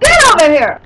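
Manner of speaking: real loud call across the room; yelling. Real, not acted. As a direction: shout it to bring her over. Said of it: "I have to yell at her".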